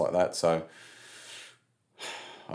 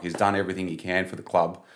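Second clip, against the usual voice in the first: usual speaking voice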